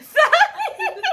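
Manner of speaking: high, loud, stretched out; over-articulate it slowly; slow exaggerated mouthing. Exaggerated mouthing for lip reading.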